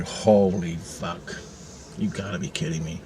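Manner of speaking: retold hushed astonishment; stunned disbelief. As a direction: drag it out low in dumbstruck awe. Stunned reaction to helicopters, retold.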